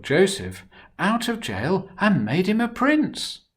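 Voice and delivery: lively, expressive storybook reading; reading to children. A narrator's reading. Storyteller reading Joseph's story to children, animated. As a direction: tell it to the little ones with big feeling.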